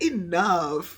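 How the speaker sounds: loud, drawn-out retort; mock exasperated shout. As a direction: call it out loud to cut someone off. Acted brother cuts off greeting.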